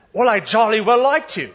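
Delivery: a jolly English constable, hammed up; English bobby. English officer in joke.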